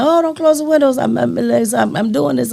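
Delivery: high pleading protest; high-pitched mock plea; self-quote pleading. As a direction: beg them to leave the window open. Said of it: "I'm like".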